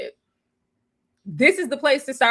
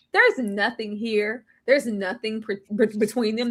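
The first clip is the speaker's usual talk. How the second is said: ordinary talk, nothing marked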